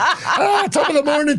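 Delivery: high mock-Irish leprechaun call. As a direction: shout it like a cartoon leprechaun. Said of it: "dress up as leprechauns".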